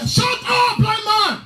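high mock jeer; mocking crowd. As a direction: bark it down at the blind man.

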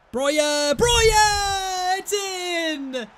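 pure joy at taking the lead; goal celebration shout. Real, not acted. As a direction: scream the name high. Goal scored away at Tottenham.

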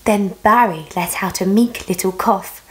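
put-on lively read-aloud for a small child; storybook narration. A narrator's reading. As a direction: rhyme it out like bedtime reading. Reads a rhyming picture book.

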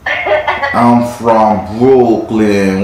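put-on slow, drawn-out Brooklyn accent with dropped letters; mock Brooklyn accent. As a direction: tease her Brooklyn accent, stretching every word. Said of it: "Why y'all don't pronounce all the letters".

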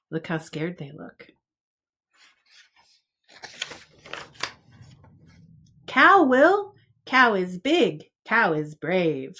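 lively picture-book read-aloud; reading to children. Acted. Teacher reading a picture book to preschoolers, lively storytime voice. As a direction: read it out like storytime for little ones.

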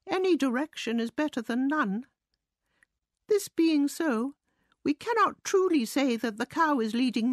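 high-pitched mouse musing to himself; self-important mouse. A narrator's reading.

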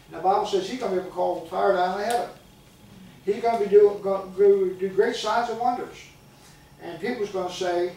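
usual speaking voice

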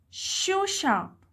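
"Shoe shop" with SHOE high, shop falling; stress on SHOE. Shown as a demonstration. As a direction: hit SHOE in "Shoe shop", then drop. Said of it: "عايش أنا شددت".

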